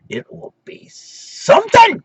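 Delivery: slow build to a high hollered finish; over-the-top hype; hyped-up showman. Pretend. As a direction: draw it out, then squeal the last word. Hyped sing-out closing the topic.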